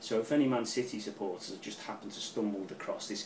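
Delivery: normal talking voice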